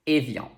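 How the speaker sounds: Evian as "ay-vyahn" with nasal ending; French pronunciation. Shown as a demonstration. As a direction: model Evian for learners, N unsounded. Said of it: "So try it with me".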